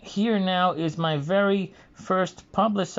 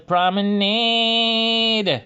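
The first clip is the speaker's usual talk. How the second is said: "Promenade" drawn out slowly, pitched higher, louder, in Bugs Bunny's odd dialect; 5 semitones higher, 4 dB louder, much slower than usual. Retold or imitated. Imitating Bugs Bunny's cartoon lyric in his unusual dialect, higher and slower. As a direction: stretch "Promenade" long and high like the cartoon rabbit singing. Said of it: "that, uh, unusual, uh, accent or dialect".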